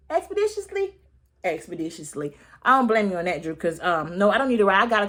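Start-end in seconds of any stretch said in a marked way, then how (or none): 0.1-1.0 high questioning squeak on expeditiously; incredulous echo. Shown as a demonstration. Vlogger echoing Drew's word in disbelief. 1.4-2.4 low, soft, falling expeditiously; flat confirming echo. Shown as a demonstration. Vlogger confirming the word, low and deadpan.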